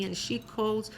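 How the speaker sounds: normal talking voice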